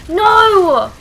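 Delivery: loud, high cry of protest; frustrated yell. Real, not acted. Killed again in game.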